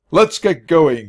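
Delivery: loud, barked command; army general order. A narrator's reading. Ranger ordering the lost mouse like an army general. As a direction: order them out like a drill sergeant. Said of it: "he ordered, sounding like an army general".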